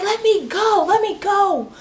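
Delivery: high mock shriek at talking volume; squealed, held-back scream; imitated screaming. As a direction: mimic a frantic demand to be released. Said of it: "And she's screaming".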